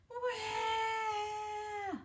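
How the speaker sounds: high drawn-out wail; baby wail. Acted. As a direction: bawl like a toddler. Wayne bawls.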